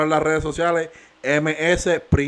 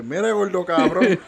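higher, louder imitated complaint; mock phone scolding; 5 semitones higher, 4 dB louder than usual. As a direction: imitate her telling someone off.

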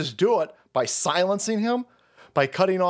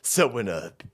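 mock smug lawyer; 8 semitones lower, 3 dB quieter than usual. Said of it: "the fucking shyster lawyer goes".

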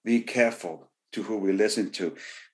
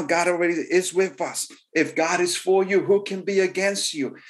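higher, fervent preaching run; preaching at full force; 6 semitones higher than usual. Acted. Preacher urging church to believe, fervent high preaching. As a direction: lift the congregation with rising fervor. Preaches Caleb's good report.

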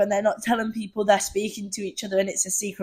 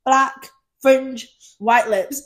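slow, listed Claudia style; much slower than usual. Retold or imitated. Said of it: "she goes".

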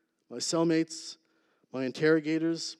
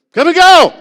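huge, high, loud barked command; warden's booming shout; 23 dB louder than usual. Retold or imitated. Stern warden shouting at the inmates. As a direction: bellow it like a stern prison warden. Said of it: "she talked like this".

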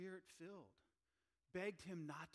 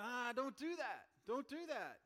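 pleading friends; 9 semitones higher, 8 dB louder, slower than usual. Retold or imitated. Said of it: "The people who are here are going".

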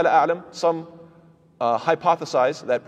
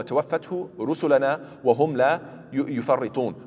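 Quran recitation; much faster than usual. Acted. Teacher quoting a verse to students, recited quickly. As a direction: recite the ayah fluently from memory.

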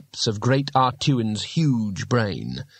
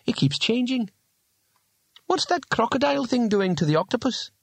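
put-on higher-pitched, puzzled young woman's voice; puzzled young lady; 11 semitones higher than usual. Bethan puzzles over the changing picture writing, high puzzled voice. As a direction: lift the voice high for a baffled question.

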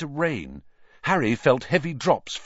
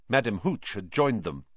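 ordinary delivery, nothing marked

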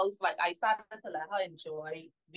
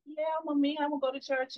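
eager daughter voice; 6 semitones higher than usual. Retold or imitated. Mother voicing her eager daughter.

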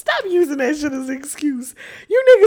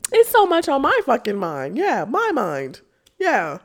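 normal talking voice

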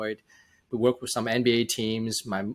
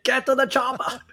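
much higher, hammed-up Austrian action-hero accent; Arnold impression; 14 semitones higher, 4 dB louder than usual. Guest teasing the host with a much higher Arnold-style accent. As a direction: bark it like Arnold in an action movie. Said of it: "more like an Arnold accent? It's like".

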